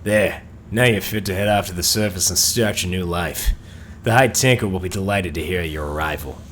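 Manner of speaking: put-on character voice with an assumed accent; technician character voice. Technician Braggle sends the survivor to the surface, acted character voice. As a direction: play a game technician seeing the player off.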